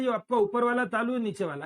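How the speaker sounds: normal talking voice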